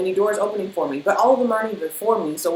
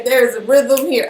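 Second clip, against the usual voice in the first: goofy emphasis; 5 semitones higher, 6 dB louder than usual. Shown as a demonstration. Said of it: "why I'm being such a goofy".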